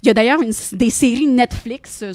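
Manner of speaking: ordinary delivery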